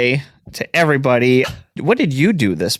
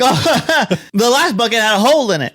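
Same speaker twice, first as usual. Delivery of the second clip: mock Southern accent; 8 semitones higher than usual. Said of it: "That's not my Southern accent".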